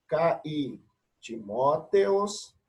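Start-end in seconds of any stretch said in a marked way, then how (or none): 1.2-2.6 Timóteos spoken slowly syllable by syllable; slow syllable reading. Shown as a demonstration. Teacher demonstrating Greek pronunciation slowly. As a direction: read Timóteos slowly as a model. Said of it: "a preocupação aqui é a pronúncia".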